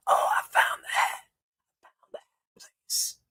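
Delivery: voiceless whispery rasp; strained, breath-only character voice; damaged vocal cords. Retold or imitated. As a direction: imitate an actor whispering through wrecked cords. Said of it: "the Nick Cage voice the whole".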